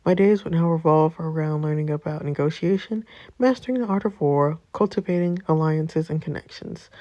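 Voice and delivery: normal talking voice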